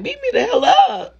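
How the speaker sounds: high, laughing echo of his threat; laughing disbelief. Retold or imitated. Mocking a guy's rough threat, high laughing echo. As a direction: laugh it back at him in a squeak. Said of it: "I'm like".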